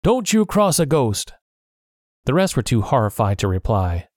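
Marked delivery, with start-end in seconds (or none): 0.0-1.3 higher, fearful warning; a scared pirate warns Silver, higher. A narrator's reading. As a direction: caution him like a superstitious sailor. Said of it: "one of the pirates warned".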